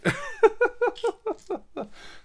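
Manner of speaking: squeaky giggle; high squealing laugh. Real, not acted. Laughing at his impression.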